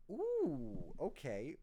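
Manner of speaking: a sudden high, loud ooh of delight; impressed ooh. Real, not acted. Reacting to the rapper's wordplay, an impressed high ooh. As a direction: caught off guard by a clever bar.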